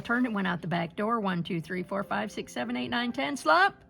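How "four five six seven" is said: usual speaking voice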